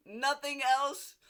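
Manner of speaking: high, loud, slow disbelieving cry; mock disbelief. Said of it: "because I was like".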